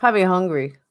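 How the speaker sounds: ordinary talk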